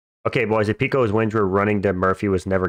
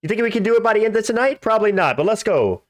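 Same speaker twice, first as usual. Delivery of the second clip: frat bro; 11 semitones higher than usual. Real, not acted. Streamer hyping subscriber goal.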